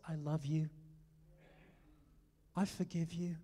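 lightly voiced tender father; tender voice of God. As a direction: speak it like a loving father forgiving. Voicing God's tender word.

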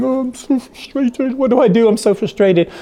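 high-pitched, hammed-up wallowing in frustration; mock frustrated. Preacher voices someone stuck in a morass of frustration, mock frustrated. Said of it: "in this morass of frustration saying".

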